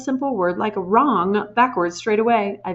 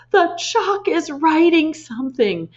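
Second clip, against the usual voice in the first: higher, slow panicked cry; shrill scream; 8 semitones higher, 4 dB louder, slower than usual. A narrator's reading. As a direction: keep shrieking. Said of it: "screamed Nigel".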